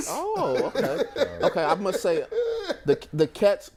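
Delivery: high, laughing through the words. Real, not acted. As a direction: laugh while reacting to the clip. Laughing at practice clip.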